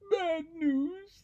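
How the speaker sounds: high, wailing mock cry; hammed-up storybook bawling; crying rabbit. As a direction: wail it like a tearful little rabbit. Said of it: "the rabbit cries".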